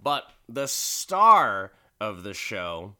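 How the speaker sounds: ordinary talk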